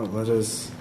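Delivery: usual speaking voice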